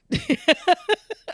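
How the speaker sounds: high-pitched giggle; laugh. Real, not acted. Co-host cracking up at the story, laughing.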